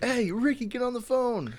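high-pitched imitation of a movie caller; mock calling out. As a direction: call a friend to the phone, hammed-up. Acts out movie character calling out.

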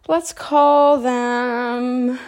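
very slow, stretched stalling; drawn out. Pretend. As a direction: drag it out to build suspense. Stalling before naming someone.

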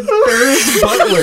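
choking out the name through laughter; barely gets it out between laughs; laughing hard. Real, not acted. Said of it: "Said, said".

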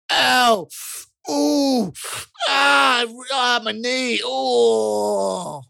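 high, hammed-up cries of pain; comic NPC wailing after a one-floor fall; pained yelps. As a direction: yelp like a man who just landed on his knee. NPC falls off balcony.

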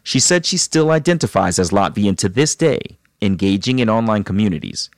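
measured storytelling read over music; steady, composed host narration; documentary narration. Acted. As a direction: narrate the guest's backstory like a podcast intro. Host narration between interview clips.

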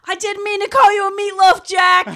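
high, loud mock yell; yelled quote. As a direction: shout it like a man mid-transformation. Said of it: "I love when he yells out".

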